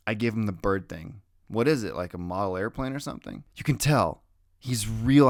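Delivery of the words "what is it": ordinary delivery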